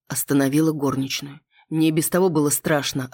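plain reading voice

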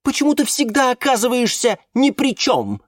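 very high, accusing question; lashing out. A narrator's reading. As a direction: attack him with indignant, soaring pitch. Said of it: "накинулась на него Валериана". (обвиняюще, на высоких тонах)

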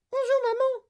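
put-on extremely high miniature boy; very high, tiny piping voice; tiny mouse-squeak voice. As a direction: squeak it out like someone an inch tall. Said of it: "Une toute petite voix, pas plus grosse que le quick d'une souris".

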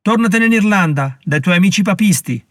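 mock yell of an angry townsman; yelled from the crowd. A narrator's reading. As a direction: hurl the insult from the crowd. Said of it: "urlò qualcuno".

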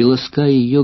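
plain reading voice

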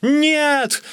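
very high, loud squeal; shrieked protest; screeching refusal. A narrator's reading. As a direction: squeal it like someone being hauled off. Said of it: "визжал я".

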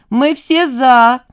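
loud, high, drawn-out cheer; eager agreement. A narrator's reading. The girl speaking for all the fans, eager agreement. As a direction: call it out slowly and gladly. Девчонки дружно соглашаются.

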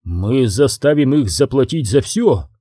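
usual speaking voice

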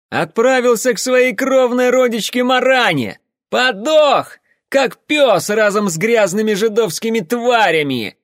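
very high, loud gloating yell; triumphant shout. Acted. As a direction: crow it out in triumph. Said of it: "Торжествующий крик".

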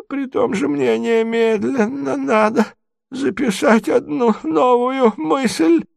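high, slow, halting feeble voice; doddering prince, bewildered. Acted. Confused prince begs to be led away, high and halting. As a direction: wander plaintively from one thought to another.

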